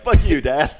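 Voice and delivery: mock bratty kid. Son yells back at his dad, loud and bratty. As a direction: snap back like a rude kid. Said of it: "he was like".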